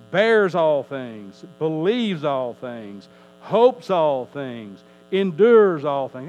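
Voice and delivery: slow preaching climax; slow emphatic build. Acted. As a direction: hammer every "all things" harder.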